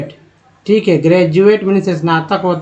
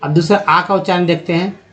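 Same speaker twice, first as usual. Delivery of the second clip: ordinary delivery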